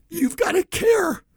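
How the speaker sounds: high, tearful voice breaking into sobs; real tears, pushing the words out through crying. Real, not acted. Radio host tells listeners he cares for clients, crying. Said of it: "I get emotional saying this".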